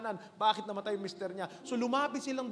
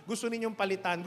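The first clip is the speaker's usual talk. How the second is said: usual speaking voice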